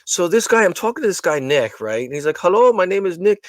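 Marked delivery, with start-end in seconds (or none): 2.4-3.4 a hint of a badly done Indian accent; retelling the agent's greeting in a weak Indian accent. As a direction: greet the caller like a call center agent, poor Indian accent. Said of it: "I do the Indian accent really bad".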